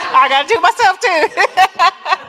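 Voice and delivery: slow, high, loud through laughter; laughing. Real, not acted. Joking to the crowd about herself, laughing hard. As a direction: laugh it out at your own joke. Laughing at her own joke.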